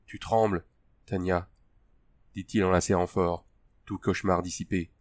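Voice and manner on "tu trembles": ordinary delivery, nothing marked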